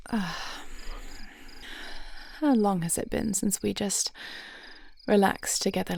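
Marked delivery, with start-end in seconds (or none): 0.1-0.6 soft, much quieter relieved ah; contented sigh. Acted. Settling beside spouse, a quiet contented ah. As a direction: let out a sigh as you settle in.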